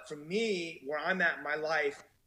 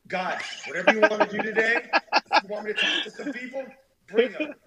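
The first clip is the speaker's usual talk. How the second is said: eager prayer; 5 semitones higher, 8 dB louder than usual. Retold or imitated. Acting out his daily prayer.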